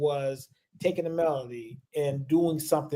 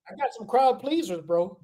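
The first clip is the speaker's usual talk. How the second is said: mock-proud brag, pitched higher; playful boast; 6 semitones higher than usual. Joking to his co-host about his licks, playful higher brag. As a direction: show off your party tricks, grinning.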